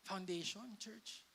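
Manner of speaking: normal talking voice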